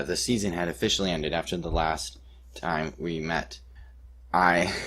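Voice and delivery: ordinary delivery, nothing marked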